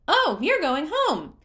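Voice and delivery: very high, loud cheer; cheerful creature. A narrator's reading. Hestu spotting Link, cheering in a very high voice. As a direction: squeal with glee at a friend.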